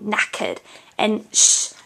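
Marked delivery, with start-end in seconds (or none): 0.0-0.6 "knackered" with a silent r, nack-uhd; British knackered. Shown as a demonstration. Teacher corrects Kelsey, British r-less knackered.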